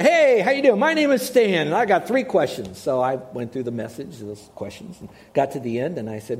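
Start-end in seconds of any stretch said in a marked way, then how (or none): 0.0-2.8 bright, much higher and louder hello; eager greeting to a stranger in his garage; cheery greeting. Retold or imitated.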